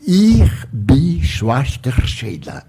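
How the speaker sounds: slow German-accented "Ir bi Schwaster Sheila", lightly voiced; a gentle German nurse introducing herself, slowly; German greeting. The nurse Sheila greets Divaldo in slow German. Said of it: "ela disse assim".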